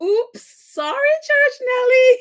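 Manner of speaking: high, loud squeal of apology; host apologizing to the wrongly blamed friend, laughing helplessly. Real, not acted. Giggly, high-pitched oops.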